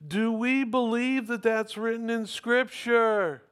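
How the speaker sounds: high, projected rhetorical question; impassioned preaching. Real, not acted. Preacher asking congregation about Scripture, impassioned challenge. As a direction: challenge the whole room with it.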